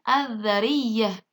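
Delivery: very slow "adzariyah" with a held voiced TH; silent lam. Shown as a demonstration. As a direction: draw out "adzariyah", no L. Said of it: "Lamnya tidak dibaca jelas".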